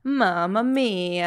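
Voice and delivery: put-on slow, drawn-out Italian-accented mamma mia; mock Italian. Mock Italian exclamation about pizza.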